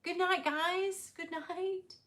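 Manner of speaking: high, drawn-out farewell; sing-song goodnight. Real, not acted. Host bids the guys going to bed good night, sing-song. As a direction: let goodnight ring out high and long.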